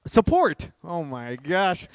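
loud, high burst of triumph at finding the word; exasperated relief. Real, not acted. Speaker finally remembers a basic word mid-sermon, exasperated relief. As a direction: blurt it out, then draw out the exclamation.

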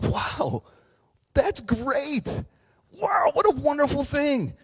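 very high-pitched fake delight; mock amazement. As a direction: gush with over-the-top, mocking wonder. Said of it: "I totally was mocking you".

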